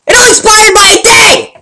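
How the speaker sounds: high, loud, furious mock shout; hammed-up angry customer yelling; angry cry. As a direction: yell it like an outraged customer. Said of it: "she, she cried out".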